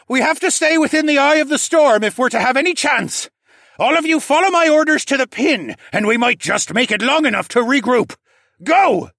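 high, loud urgent orders; rally the crew through a storm at full voice; commanding raccoon captain. Acted.

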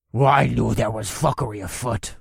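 lightly voiced growling orc; a hint of a gruff orc. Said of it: "the orc on the left growled".

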